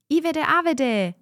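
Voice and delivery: high, loud shout of a choice; children shouting. A narrator's reading. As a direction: yell out your pick. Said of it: "schrien einige".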